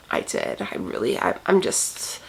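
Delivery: a mock hitman murmuring his deeds; hushed confession. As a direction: lean in and whisper it like a secret.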